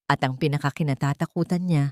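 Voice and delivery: ordinary delivery, nothing marked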